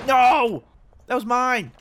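loud, high protest; outraged at a stolen kill; indignant shout. Real, not acted. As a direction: yell that the kill was his.

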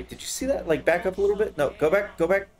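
excited. Real, not acted. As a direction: call out go back eagerly. Spotted a battery, urging partner.

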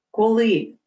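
ordinary delivery

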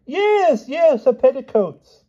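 very high, loud, drawn-out glee; giddy, tickled. A narrator's reading. King agrees about the petticoats, giddy. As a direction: squeak it out, tickled pink. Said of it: "The king was convulsed with giggles".

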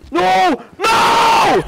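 rising to a loud, high no; dismayed shout. Real, not acted. Player hit by another setback, dismayed shout. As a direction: build from a groan to a full yell.

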